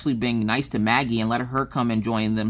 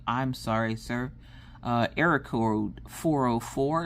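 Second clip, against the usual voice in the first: mock computer error voice; 6 dB quieter, slower than usual.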